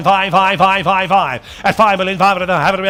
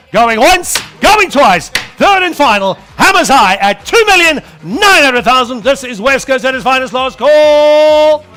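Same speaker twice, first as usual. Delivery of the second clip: loud, high hammer call; auctioneer; 4 semitones higher, 6 dB louder than usual. Acted. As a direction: build to the sale, calling out.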